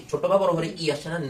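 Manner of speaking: normal talking voice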